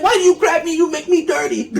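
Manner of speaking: high squeaky put-on woman's voice; lightly hammed-up young wife; mock prissy wife. Retelling the movie, voicing the wife in a high mock voice.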